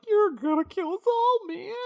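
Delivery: very high, loud mock shriek; panicked pawnbroker. Jeeves as the device starts glowing, hammed-up panic. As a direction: squeal in cartoon terror.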